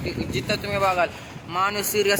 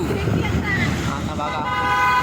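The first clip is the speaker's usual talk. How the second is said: drawn-out announcement; 13 semitones higher, 4 dB louder, much slower than usual. Real, not acted. Entering tunnel, drawn out.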